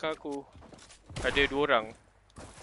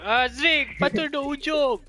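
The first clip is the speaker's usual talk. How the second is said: loud high teasing call; 12 semitones higher, 15 dB louder than usual. Real, not acted. Teasing call across room.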